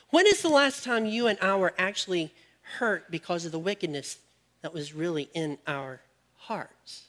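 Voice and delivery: ordinary talk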